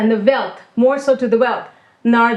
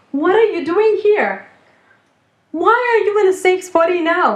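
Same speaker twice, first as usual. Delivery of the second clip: high, urgent voice of a shocked sage; astonished sage; 7 semitones higher than usual. Acted. Narada Muni finding Kailash in a dog's body, shocked and high. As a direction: rise high in disbelief at the dog.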